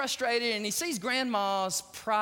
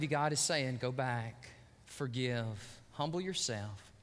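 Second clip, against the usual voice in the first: low, soft pastoral invitation; altar invitation; 8 semitones lower, 6 dB quieter than usual. Acted. Pastor invites congregation to bow heads, hushed and earnest. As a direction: lead them quietly into reflection.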